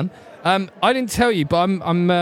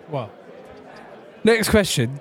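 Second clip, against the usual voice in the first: normal talking voice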